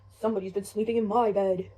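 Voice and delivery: low, loud big bear voice; Papa Bear. Acted. Father bear seeing his bed was slept in, deep and loud. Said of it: "Papa Bear comes over".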